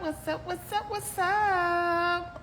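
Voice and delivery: high, drawn-out sing-song hellos; bubbly hey to the viewers; excited greeting. Real, not acted. Greeting her Instagram live viewers, high and sing-song.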